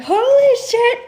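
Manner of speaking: high, loud astonished cry; amazed exclamation. Retold or imitated. Vlogger hearing her claim confirmed, loud and high. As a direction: blurt it out in shock. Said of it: "I was like".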